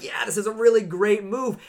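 hammed-up overconfidence; smug self-quote. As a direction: play your past self as sure and pleased. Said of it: "I'm like".

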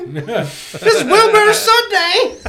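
hammed-up high, loud, drawn-out voice; two octaves up. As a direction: announce yourself in a silly high voice, slowly. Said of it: "you're gonna go up two octaves".

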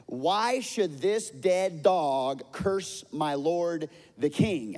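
loud, slow outraged question; indignant demand. Acted. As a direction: demand it on the king's behalf.

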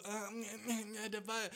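hammed-up helpless fluster; flailing ball-chaser. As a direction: play someone fumbling after a ball.

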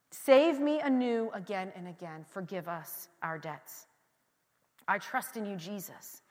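slow, low, hushed prayer; quiet prayer. Acted. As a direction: sink into a soft, surrendered plea.

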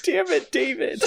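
yelping his friend's name through laughter; cracking up while complaining; laughing protest. Real, not acted. Laughing at the friend who stole his joke.

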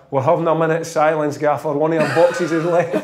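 ordinary talk

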